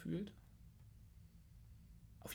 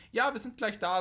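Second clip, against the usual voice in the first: raised, high voice as if speaking into a small device; calling into a watch; 9 semitones higher, 6 dB louder than usual. Retold or imitated. Retelling a friend answering a call on her watch, raised voice. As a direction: lift the voice to reach the watch mic.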